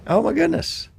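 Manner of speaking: a high, rising oh my goodness; surprised. Real, not acted. Host hearing the guest worked across the bay, surprised. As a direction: pitch shoots way up in delight.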